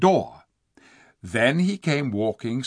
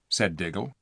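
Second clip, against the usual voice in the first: usual speaking voice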